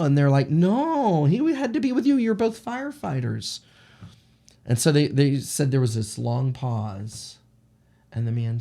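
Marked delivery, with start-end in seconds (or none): 0.5-3.6 high-pitched, loud retold protest; insistent disbelief. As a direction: argue back in acted disbelief, pitch way up. Acted dispute over a missing rescuer.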